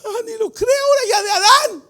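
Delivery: high, fast, breaking exclamation; preaching cry. Real, not acted. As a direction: cry it out in fervor. Preacher crying out at climax.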